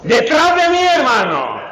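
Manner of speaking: sudden high, loud command, then settling; stern rebuke. Retold or imitated. Christ rebuking Peter with a loud command. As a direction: snap the order out.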